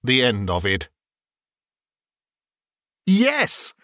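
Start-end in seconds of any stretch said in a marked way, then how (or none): 3.0-3.7 high-pitched exultant cry; joyful relief. Acted. Scrooge finds himself in his own bed, overjoyed.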